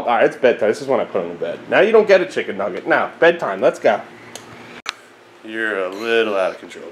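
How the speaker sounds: usual speaking voice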